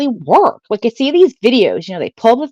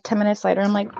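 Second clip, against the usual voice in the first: ordinary talk, nothing marked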